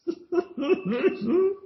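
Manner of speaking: high burst of laughter; cracking up high. Real, not acted. Laughing at horse joke.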